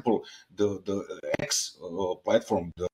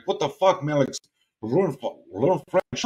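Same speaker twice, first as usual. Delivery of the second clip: mildly heated scolding, pitched higher; retold telling-off in a raised pitch; exasperated scolding; 6 semitones higher than usual. Telling Serbs in France to learn the language, exasperated.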